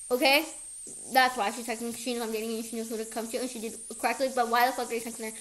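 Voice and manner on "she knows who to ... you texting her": normal talking voice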